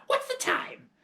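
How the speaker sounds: put-on high cranky old-woman nagging; scolding grandmother. A narrator's reading. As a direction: nag the boy without a breath.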